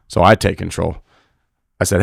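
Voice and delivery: ordinary delivery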